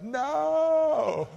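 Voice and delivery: high, drawn-out protesting no; answering the deacon with a high drawn-out no; emphatic no. Retold or imitated. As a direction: cry out no, way up high.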